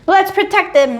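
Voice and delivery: high, loud eager angel; squeaky keen angel voice. Acted. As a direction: pipe up like an eager guardian angel.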